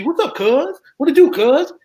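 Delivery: high, sing-song California street talk; movie West Coast slang. Retold or imitated. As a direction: ham up the movie Cali greeting, pitch way up. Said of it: "I thought all y'all talk like that".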